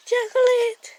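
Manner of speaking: high, loud call of the name; excited call. Real, not acted. Calling her cat Chocolate, a high excited call. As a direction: call the cat over brightly.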